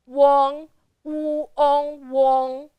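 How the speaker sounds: wu, ong, wong, each held level; first tone. Shown as a demonstration. Teacher sounding out wong, keeping the sound flat. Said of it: "Wong is also the first tone".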